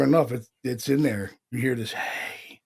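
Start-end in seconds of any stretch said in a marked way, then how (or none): 1.9-2.6 very soft whispered hey. Retold or imitated. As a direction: breathe it like a ghost on tape. Imitating the recorded voice.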